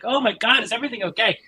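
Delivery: high, loud imitation of a worried mother; alarmed mother. Mother checking after hearing screams from upstairs, mock alarm. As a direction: act out mom's startled concern. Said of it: "my mother was not clear what was going on".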